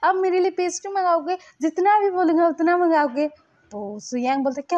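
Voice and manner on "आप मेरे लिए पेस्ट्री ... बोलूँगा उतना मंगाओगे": high, loud, suddenly delighted; light up like a kid offered sweets; happy. Retold or imitated. Lanson thrilled Suyang will order pastries, excited. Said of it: "एकदम से खुश हो जाता है".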